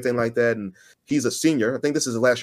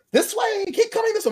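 way up high, sing-song calling out; high-pitched voice. Retold or imitated. Friend calling out across the hall in a high voice. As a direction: call him over in a thin, high voice. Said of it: "That high pitch".

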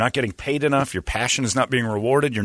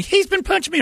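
put-on much higher squeaky kid voice on "He's"; mock little kid, pitch jumped way up; second kid accusing. Two kids blaming each other.

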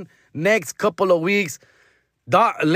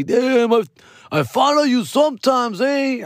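higher-pitched tipsy fan; mock drunk guy, pitched up; drunk fan impression; 6 semitones higher, slower than usual. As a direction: imitate a drunk fan chatting up a celebrity. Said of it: "They were kinda drunk".

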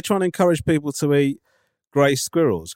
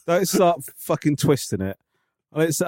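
usual speaking voice

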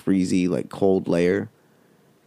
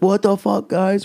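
much higher, startled mock yell; alarmed yelp; 11 semitones higher than usual. Friend running out of the bathroom: high startled yelp. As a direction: squeak it out like you just saw a spider.

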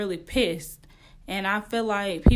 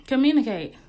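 "communicate" punched, louder and higher; emphatic communicate; 4 semitones higher, 7 dB louder than usual. Real, not acted. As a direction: hit "communicate" hard. Stressing the key advice.

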